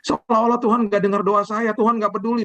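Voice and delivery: high, complaining cry to God. Retold or imitated. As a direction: act out a fretful believer's high whine. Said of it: "akan teriak-teriak seperti begitu".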